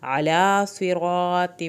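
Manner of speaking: علی from the throat, صراط with heavy s and r; slow chanted phrase; throaty ain, heavy s. Shown as a demonstration. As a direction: ain from throat, full saad. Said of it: "عین کو حلق سے پڑھیں".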